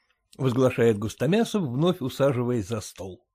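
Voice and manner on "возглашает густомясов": ordinary delivery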